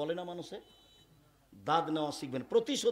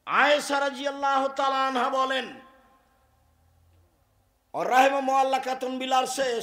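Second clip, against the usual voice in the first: loud, high chanted hadith recitation; preaching at full force; 8 semitones higher, 10 dB louder than usual. Acted. Preacher proclaiming the Prophet's hadith loudly. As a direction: proclaim it to the whole hall.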